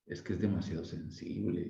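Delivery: hint that something is off about him; suspicious little tone. Retold or imitated. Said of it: "con un tonito así muy, muy sospechoso".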